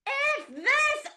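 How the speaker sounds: very high, sudden yelp of F this; startled panic. Retold or imitated. Spotting a snake on set, panicked high yelp. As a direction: leap back from the snake with a yelp. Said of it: "I was like".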